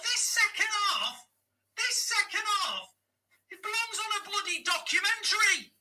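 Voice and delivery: high-pitched disbelieving rant; mock the defence in a strained, incredulous voice; exasperated rant. Real, not acted. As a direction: fan venting sarcastic fury at his own team. Fan ranting after defensive blunder.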